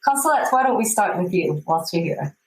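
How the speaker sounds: ordinary talk, nothing marked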